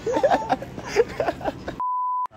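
high laughing fit; real laughter. Real, not acted. Prankster cracking up after being told on, real laughter. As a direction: laugh helplessly, high-pitched.

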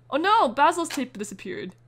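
gasp, then a high, loud alarmed cry; shocked gasp. Real, not acted. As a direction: caught off guard by bad news. Tape vanishes in game.

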